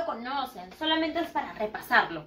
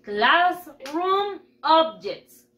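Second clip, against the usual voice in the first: very slow, word by word for pupils; modelo para repetir; 2 semitones higher, much slower than usual. Shown as a demonstration. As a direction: stretch out "Classroom objects" for repeating. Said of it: "Repeat after me". (modelo lento para repetir)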